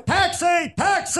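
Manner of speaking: high-pitched taxi call; big, high street holler; hailing a cab. Retold or imitated.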